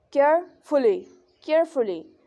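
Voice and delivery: "Carefully" in two deliberate halves, pitch moving on each; falling-rising tone. Shown as a demonstration. Teacher giving an example word, falling-rising tone. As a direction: model "carefully" as a separate, emphatic example. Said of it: "symbol falling rising tone".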